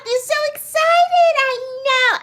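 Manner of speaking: very high, excited squeal. Real, not acted. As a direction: squeal it with joy. Greeting a viewer with delight.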